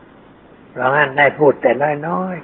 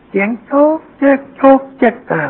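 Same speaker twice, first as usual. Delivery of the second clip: chatter noise; 11 semitones higher than usual. Retold or imitated.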